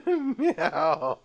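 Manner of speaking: high, laughing meow; giggly cat meow. Acted. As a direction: meow back through a laugh. Meowing contest on prank call.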